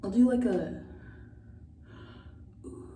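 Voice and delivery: ordinary talk, nothing marked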